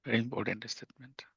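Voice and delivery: whispered, breathy emphasis; hushed aside. Real, not acted. Preacher to his listeners, stressing the statement, in a whisper.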